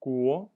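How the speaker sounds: "Guo" loud with a rising second tone. Shown as a demonstration. Teacher modeling Chinese for learners, loud rising tone. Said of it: "the rising tone".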